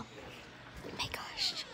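slow drawn-out high whisper. Real, not acted. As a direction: stretch it out in a whisper. Quiet reaction in the car.

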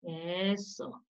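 ordinary talk

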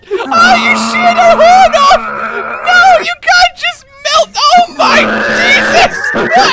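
high-pitched, hysterical exclaiming; squealed disbelief; shocked shout. Real, not acted. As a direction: cry out in amused horror. Shocked cries at the monster's injury.

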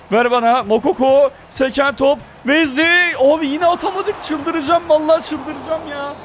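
high, loud exasperated yelling; frustrated shouting. Real, not acted. Player raging at his team after another missed goal, frustrated shouting.

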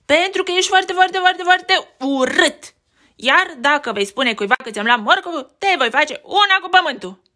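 bully rabbit taunt. Acted. Bully taunts Beni again.

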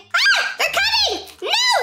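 high loud frantic alarm; high-pitched panicked yell; panicked scream. Real, not acted. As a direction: shriek as pursuers close in. Chased by game guards.

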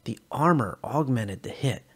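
usual speaking voice

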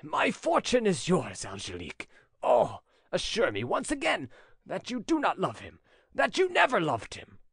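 anxious lover pleading, pitch swinging upward; faltering plea. A narrator's reading. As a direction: plead earnestly, lifting the key phrases high. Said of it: "he pleaded in a faltering voice".

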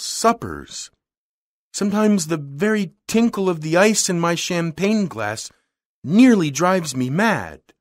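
a hint of a bored society lady, voice lifting high; tired of it all, high and a little pushed; lightly voiced weary heiress; weary rich lady. A narrator's reading. Girl voiced by narrator.

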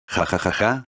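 a soft, read-out ha ha ha; hint of a chuckle; spelled-out laugh. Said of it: "rió Goku".

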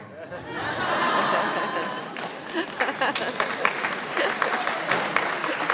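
a long, high, breathless laugh; real laughter after the punchline; laughing at own joke. Real, not acted.

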